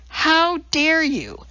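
high, slow indignant outburst; outraged. A narrator's reading. Scientist rounding on the woman who insulted her, outraged. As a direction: let the outrage crack out high.

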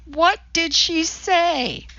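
high, slow, strained; a stricken old man, high and slow; shocked old man. A narrator's reading. Walter shocked, bedridden.

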